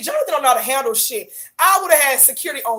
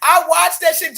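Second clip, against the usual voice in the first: ordinary talk, nothing marked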